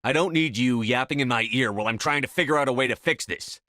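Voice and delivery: frustrated outburst at a friend trying to help; snapping. Acted. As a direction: bite her head off out of stress. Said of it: "I didn't mean to snap at you".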